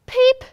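high loud chick peep; short piercing peep. A narrator's reading. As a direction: squeak out a sudden peep. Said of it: "said the poor wolf".

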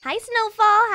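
bright, high hello; cheerful greeting. Real, not acted. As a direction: greet a friend warmly. Greeting game character.

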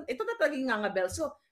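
ordinary talk, nothing marked

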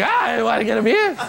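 high showbiz farewell; a hint of a cheesy announcer; mock host sign-off. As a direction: wrap up like a TV host. Mock talk-show sign-off.

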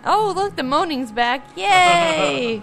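high, loud sarcastic cheer; big fake-happy "yay"; mock delight. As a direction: cheer it like dreaded news is wonderful. Mock cheering at noises she dislikes.

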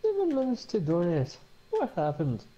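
a hint of Irish; Irish accent. Shown as a demonstration. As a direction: try the Irish accent on the donation joke. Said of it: "A bit more like that".

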